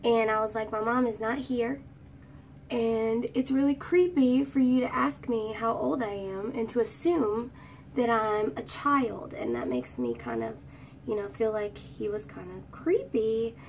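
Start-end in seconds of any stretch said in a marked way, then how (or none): none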